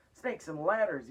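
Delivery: yelled. A narrator's reading. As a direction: bellow it in horror. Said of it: "yelled the head of the fire department".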